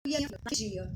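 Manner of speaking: breaking whisper. Real, not acted. Mother speaking of the day her son died, whispering. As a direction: let it fade to a grieving whisper. Grief at son's death.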